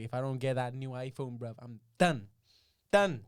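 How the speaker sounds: put-on loud London accent, last word shouted; London geezer voice, rising to a shout. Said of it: "You just doing a London accent?".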